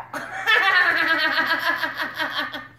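loud, high cackle; a long hammed-up guffaw; character laugh. [name] laughs at his wheelbarrow callback, loud cackle.

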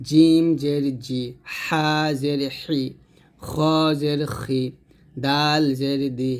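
slow, syllable by syllable; slow drill recitation. Shown as a demonstration. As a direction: recite the letters slowly for students to repeat. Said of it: "তো আবার বললেন".

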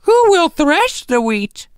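high, slow, hopeful hen voice; hopeful hen call. A narrator's reading. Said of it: "in a very hopeful tone".

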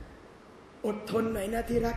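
soft, slow อดทนหน่อยนะที่รัก, drawn out gently; whisper-soft comfort, very slow; tender murmur to wife. Shown as a demonstration. Husband hugging his wife, soothing her tenderly. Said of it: "พูดคำนี้พี่น้อง".

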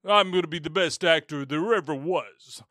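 mock grand thespian voice; hammy actor. Mocking the monologuing actor, hammy put-on voice. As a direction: declaim it like a ham actor.

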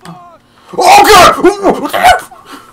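high, loud drawn-out cry; mock panicked shout. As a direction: yell in alarm, half laughing. Cries out in game danger.

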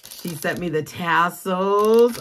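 usual speaking voice

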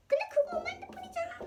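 high, fast protest; excited protest. Real, not acted. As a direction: blurt it out in protest. Child protests quickly.

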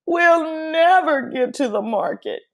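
high whiny complaint; boy's despair. Acted. Pablo complaining to Abuela, high and whiny. Boy despairing over more work.

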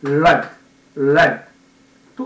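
ライト as a short, loud English "red"; shortened blend. Shown as a demonstration. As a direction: blend R, eh, d into one punchy "red". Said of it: "短くすると".

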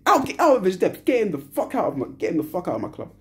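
ordinary talk, nothing marked

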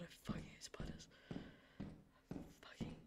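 whispered in fear; scared whisper. Real, not acted. Hiding from spiders in the game, scared whisper. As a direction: hiss it under your breath, frightened. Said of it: "I'm going to sob".

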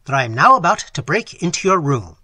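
loud warning called through a keyhole; loud cry at the keyhole. Acted. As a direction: shout the notice into the lock. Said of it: "he cried loudly at the keyhole".

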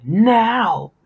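high, loud barked order; angry shout. A narrator's reading. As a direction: slam it down like a command. Father smashes table, demands.

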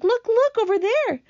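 high, urgent calls; excited shouting. A narrator's reading. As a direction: draw everyone's eyes to the sight. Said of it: "shouted the ladybug".

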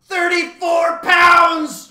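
high, drawn-out bird shriek; long, piercing shrieked call. A narrator's reading. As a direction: screech each word slowly at full blast. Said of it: "shrieked the bird".

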